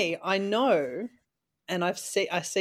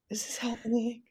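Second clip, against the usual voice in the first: whispered, softer comic lament; mock despair; 5 semitones higher, 6 dB quieter than usual. Co-host lamenting her scan results, whispered mock despair. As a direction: drop to a high, helpless whisper.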